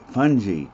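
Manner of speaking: Fungi said FUN-jee, soft g, firm and falling. Shown as a demonstration. Teacher confirming the right way to say Fungi. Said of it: "the way we really want to say that".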